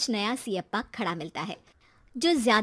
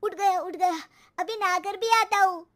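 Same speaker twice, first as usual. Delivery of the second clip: higher, louder panicky reply; hurried; 7 semitones higher, 6 dB louder than usual. Acted. Son leaping out of bed, panicked and loud. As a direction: blurt it out in a rush.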